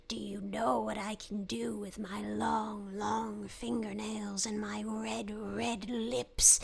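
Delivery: low, slow, whispery witch. Acted. Witch at a kid's window at night, slow creepy whisper. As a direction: draw out each menace in a hushed low croak. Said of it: "That's a good witch voice".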